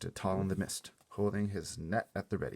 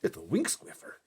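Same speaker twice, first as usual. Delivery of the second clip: louder, hushed delight; thrilled whisper; 3 semitones higher, 9 dB louder than usual. A narrator's reading. Giant identifies the caught dream, thrilled whisper. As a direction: whisper the name like a treasure. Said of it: "he whispered with a thrill in his voice".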